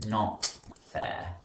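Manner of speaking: usual speaking voice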